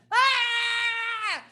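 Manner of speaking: high, held mock wail; mock scream. Acting out a child's big emotion, high held scream. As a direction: let out a child's long, high pretend scream.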